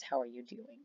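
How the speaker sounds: polite cover-up. Shown as a demonstration. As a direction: hide the feeling under a polite hello. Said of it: "what I'm actually saying is".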